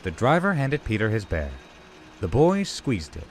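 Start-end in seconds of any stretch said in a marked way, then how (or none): none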